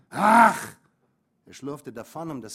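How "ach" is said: loud, sharp, much louder burst; exasperated outburst. Acted. Father giving in to the mother with a disgusted ach. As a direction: throw up your hands in disgust.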